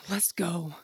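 weary whisper. Acted. Tasha sighs, defeated.

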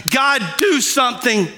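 high, urgent plea to God; crying out. Acted. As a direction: plead desperately, piling up each appeal. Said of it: "He was crying out to God".

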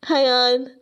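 ordinary delivery

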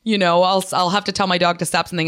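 usual speaking voice

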